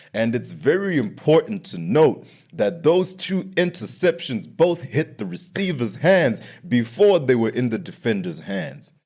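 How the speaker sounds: normal talking voice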